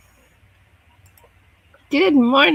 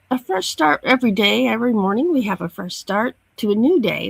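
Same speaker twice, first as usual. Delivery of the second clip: ordinary talk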